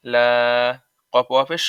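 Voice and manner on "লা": লা with a lengthened, even vowel; sustained recitation vowel on লা; madd on লা. Shown as a demonstration. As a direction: hold the long a of লা steadily. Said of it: "এক আলিফ মাদে আসলি".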